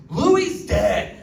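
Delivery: high, loud panicked blurt. Retold or imitated. Comedian blurting a panicked text reply, loud and high.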